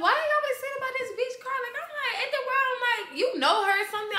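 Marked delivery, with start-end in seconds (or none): 0.0-1.6 high, rapid retold challenge; accusing question. Woman retelling how she confronted her man, accusing. 3.2-4.2 high, loud retold challenge; accusing question. Woman retelling her suspicion about another girl, accusing.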